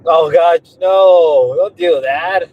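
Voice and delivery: normal talking voice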